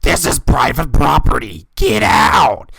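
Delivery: acted-out furious shout; imitated screaming. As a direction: bellow like a man chasing off a trespasser. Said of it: "He started screaming".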